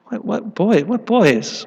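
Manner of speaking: a puzzled husband who has no idea; baffled husband. Retold or imitated.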